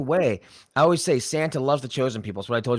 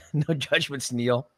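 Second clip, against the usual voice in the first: teasing; slower than usual. Acted. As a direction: rib him gently with a grin. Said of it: "I'm just kidding".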